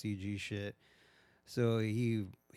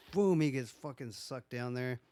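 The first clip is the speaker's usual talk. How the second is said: ordinary talk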